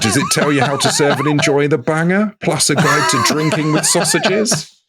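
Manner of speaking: high-pitched, talking through laughter; laughing. Real, not acted. Teasing co-host about the sausage book, helpless laughter. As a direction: ask it while cracking up, pitch climbing. Teasing question while cracking up.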